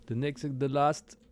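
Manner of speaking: playful fake English accent; mock English accent. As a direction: drawl it out like a bad Englishman. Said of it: "Magnifique accent anglais".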